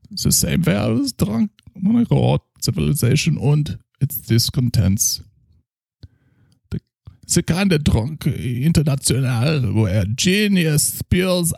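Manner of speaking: put-on a drunk German doctor praising his schnapps; heavy German accent, tipsy. Character continues.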